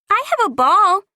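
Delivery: put-on very high, loud girl's offer; eager little girl. A narrator's reading. Small girl offering her ball to the boy, very high and eager.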